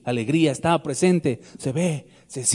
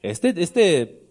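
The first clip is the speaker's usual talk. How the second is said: usual speaking voice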